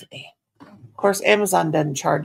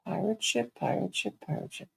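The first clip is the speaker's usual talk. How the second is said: fading mutter; 9 dB quieter than usual. Real, not acted. Muttering while reading screen.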